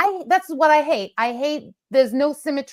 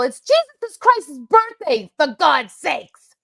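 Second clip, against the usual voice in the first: exasperated outburst; 4 semitones higher, 5 dB louder, slower than usual. Real, not acted. Exasperated outburst about Christmas.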